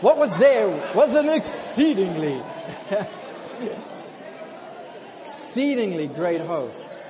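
loud, laughing preacher proclamation. Shown as a demonstration. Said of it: "put your preacher voice on this morning".

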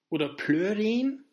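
ordinary talk, nothing marked

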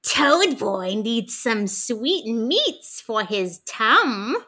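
very slow, creepy creature voice; slow creepy Toad Boy voice; terrifying creepy voice. Retold or imitated. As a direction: drag it out like a creepy monster. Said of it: "in the most terrifying voice says".

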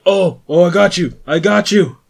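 loud, high shout to a comrade; excited rescuer call. Acted. Tiny runs to a fallen comrade, calling out loudly. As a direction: call out loudly, rushing to help. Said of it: "Tiny's like".

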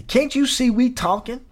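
high, snippy rude clerk; nasty retort. Retold or imitated. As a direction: snap back at him rudely. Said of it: "one lady looked at him all nasty".

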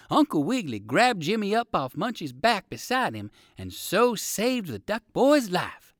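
ordinary delivery

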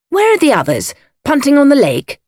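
high, breezy, jaunty questions; hearty young gentleman. A narrator's reading. Jimmy chatting to Lady Coote, breezily. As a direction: toss out cheerful questions. Cheery young man chats to hostess.

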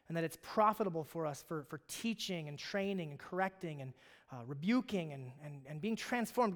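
soft, unhurried prayer voice leading the church; pastoral prayer. Acted. Pastor leading the church in prayer, softly and reverently. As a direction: pray it gently over the congregation. Opening prayer before the sermon.

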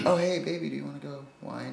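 softly murmured pretend pick-up line; hushed, hammed-up flirting; mock come-on. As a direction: play a sweet-talker, trailing off very quiet. Acts out a date invitation.

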